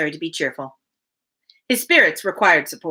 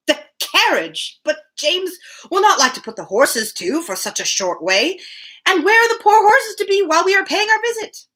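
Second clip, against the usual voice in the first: put-on fretful old father; 9 semitones higher than usual. Mr Woodhouse fretting to Emma about the horses, high and anxious. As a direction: worry about the poor horses.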